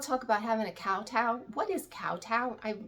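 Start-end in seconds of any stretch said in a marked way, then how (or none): none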